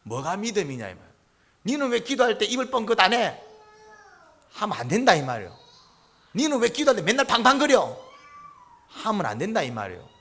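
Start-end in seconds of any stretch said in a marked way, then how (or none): 1.6-3.4 raised, high-pitched nagging question; mock scolding. Believer faulting another for praying silently, mock scolding. 6.4-8.0 loud, high, accusing question; mock scolding. Believer faulting another for noisy prayer, mock scolding.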